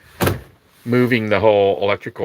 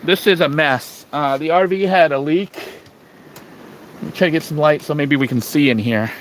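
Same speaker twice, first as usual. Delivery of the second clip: raised voice over the wind; shouting over wind; 6 semitones higher than usual. Real, not acted. Outside in strong wind.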